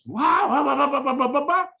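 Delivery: high mocking chuckle through each wow; mocking laughter. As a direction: laugh it off like a cocky hotshot. Brash guy mocks dad.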